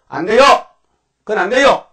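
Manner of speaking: loud, high cry of protest; alarmed shout. Retold or imitated. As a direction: shout no, stop him. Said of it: "베드로가 딱 나타나서". (베드로의 다급한 외침)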